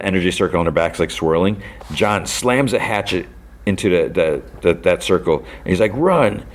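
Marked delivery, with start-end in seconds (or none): 6.0-6.5 high, imitated cry of warning; urgent command. Fighter warning the others mid-battle, urgent command. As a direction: call it out high, mock-urgent.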